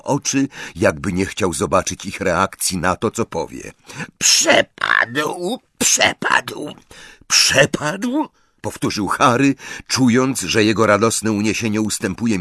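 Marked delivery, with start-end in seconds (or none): 4.2-6.7 high, wailing elf voice; a miserable little elf, pitched high; whining house-elf. A narrator's reading. Elf admits the locket is gone. 7.3-8.3 higher, rising in shock; can't believe what he heard; disbelieving echo. A narrator's reading. Harry echoes in disbelief.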